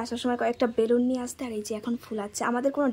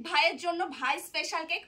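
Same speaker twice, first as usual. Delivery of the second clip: ordinary talk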